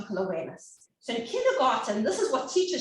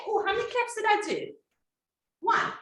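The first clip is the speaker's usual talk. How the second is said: sing-song teacher voice; 11 semitones higher than usual. Shown as a demonstration. Teacher modelling for small children.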